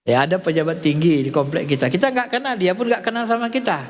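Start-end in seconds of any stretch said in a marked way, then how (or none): none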